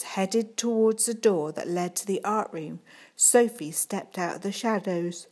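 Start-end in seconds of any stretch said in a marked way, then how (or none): none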